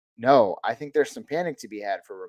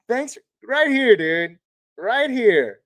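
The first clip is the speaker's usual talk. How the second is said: excited thanks; 8 semitones higher, 4 dB louder, slower than usual. Real, not acted. Thanking a donor.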